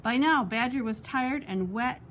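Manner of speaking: ordinary delivery, nothing marked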